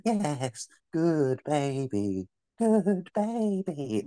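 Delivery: put-on slow, creepy baby talk; creepy cooing. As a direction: coo it slowly to a pet. Cooing to dead cat.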